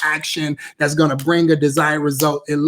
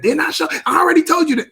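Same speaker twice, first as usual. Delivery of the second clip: mock yell; 11 semitones higher, faster than usual. Parent scolding a child at home, shouting. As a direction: bark it at the kid in frustration. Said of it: "You don't have to say it like that".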